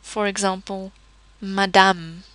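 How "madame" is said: madame ending on the M; silent final E. Shown as a demonstration. Teacher giving example, madame with silent final E. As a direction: drop the last E of madame. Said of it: "it is usually not pronounced at all".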